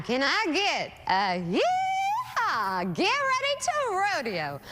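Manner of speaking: drawn-out, rising yeehaw call; hyped announcer. Real, not acted. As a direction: hype up the crowd. Anchor hyping rodeo segment.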